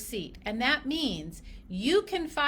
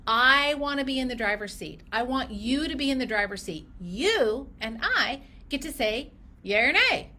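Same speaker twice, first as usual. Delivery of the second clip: normal talking voice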